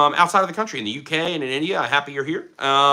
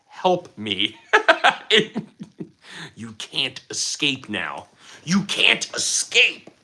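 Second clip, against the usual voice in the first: mock menace; 4 semitones lower, much slower than usual. Mock plea then menacing catchphrase.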